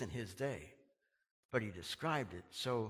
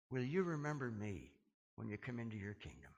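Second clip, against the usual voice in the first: softer, fading plea; humble plea; 4 semitones lower, 8 dB quieter than usual. Retold or imitated. Repentant thief asking Jesus, soft humble plea. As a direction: ask it humbly, almost under breath.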